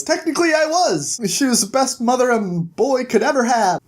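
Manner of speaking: high-pitched mock-proud comeback; retold retort.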